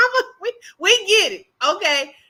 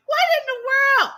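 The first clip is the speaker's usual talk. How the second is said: disbelief; 9 semitones higher, much faster than usual. Real, not acted. Disbelief at the clip.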